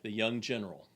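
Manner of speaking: normal talking voice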